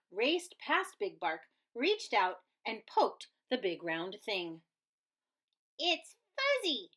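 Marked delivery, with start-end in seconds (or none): 5.8-6.9 little critter voice, pitched well up; small high voice. Acted. Pip touching the fuzz, small high voice. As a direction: squeak it out like a tiny curious pup. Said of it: "said Pip".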